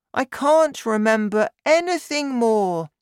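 put-on high, loud boy voice; high-pitched child's protest; George, helpless. A narrator's reading. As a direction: wail it like a stumped boy.

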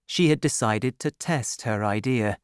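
ordinary delivery

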